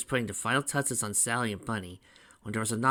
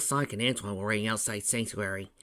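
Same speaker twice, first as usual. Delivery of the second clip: ordinary delivery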